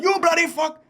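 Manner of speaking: very high, hammed-up Indian accent; mock Indian accent. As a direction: squawk the insult in a fake Indian accent. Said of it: "he was like".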